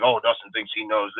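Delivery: low, soft sarcastic mock voice; mimicking a sarcastic viewer; mocking a commenter. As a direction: sneer it like a smug commenter. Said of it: "he's like".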